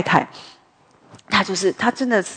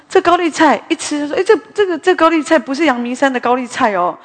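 raised, picky complaint about the cabbage; higher and louder, a husband finding fault; fussy husband complaint; 6 semitones higher, 3 dB louder than usual. Retold or imitated. Husband complaining at dinner that the cabbage isn't from Yangmingshan, fussy raised voice.

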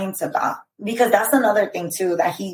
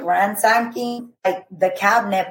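ordinary delivery

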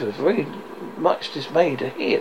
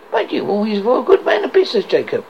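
faltering; 6 semitones higher, 4 dB louder, faster than usual. Acted. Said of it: "faltered Scrooge".

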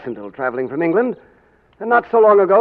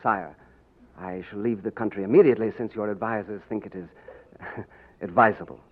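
ordinary talk

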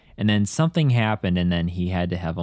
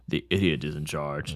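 gruff villain quote; 5 semitones lower, 5 dB quieter than usual. Retold or imitated. Quoting the villain's line.

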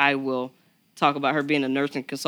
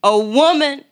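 rising, louder announcer-style build; hype introduction; 6 semitones higher, 4 dB louder than usual. Acted. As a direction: talk her up like a big stage intro. Hyping up the guest's introduction.

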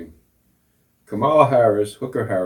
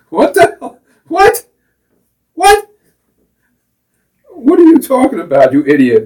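much higher, much louder, disbelieving yell; outraged shout; 14 semitones higher, 10 dB louder than usual. Real, not acted. Host yelling in disbelief at the vice president's question. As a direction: blow up in disbelief. Outraged reaction to Kamala quote.

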